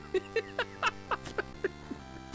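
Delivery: long, high laughing fit; helpless laugh. Real, not acted. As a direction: laugh in sheer exasperation. Cracking up in despair.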